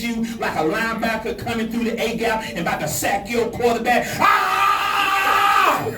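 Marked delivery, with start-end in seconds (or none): 4.2-5.8 high, loud angry shout; big frustrated release yell; demo yell. Shown as a demonstration. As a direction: scream it out. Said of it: "if you really angry and frustrated".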